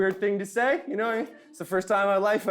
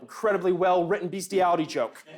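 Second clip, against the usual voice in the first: ordinary talk, nothing marked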